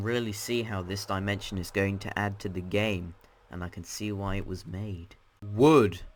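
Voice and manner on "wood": loud one-word title; announcer call. Real, not acted. Host naming the next dimension for viewers, loud announcement. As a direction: punch out the name loudly.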